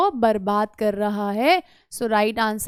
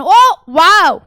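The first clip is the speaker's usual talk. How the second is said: excited wow; 5 semitones higher, 10 dB louder, much slower than usual. Acted. Teacher cheers the right option.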